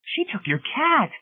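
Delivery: put-on very high, slow child's accusation; shrill, accusing. A narrator's reading. David Pritchett speaking up about the taken cat, shrill and accusing. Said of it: "spoke up shrilly, in a loud, accusing voice".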